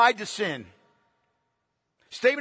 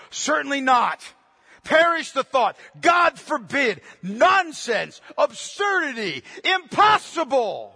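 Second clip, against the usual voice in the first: string of punched exclamations; emphatic preacher exclaiming in rejection; forceful, emphatic rejection; 2 semitones higher, 4 dB louder, slower than usual. Acted. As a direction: fire off each refusal as its own outburst. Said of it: "Paul responds so forcefully and so emphatically".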